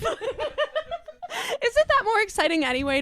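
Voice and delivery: high, slow, speaking through laughter; laughing. Real, not acted. Friend riffing on the plane Karen, laughing hard. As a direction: push the question out through giggles.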